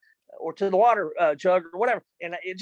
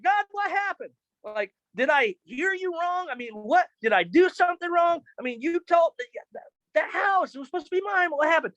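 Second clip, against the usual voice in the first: pleading; 9 semitones higher than usual. Retold or imitated. Pleading to God after loss.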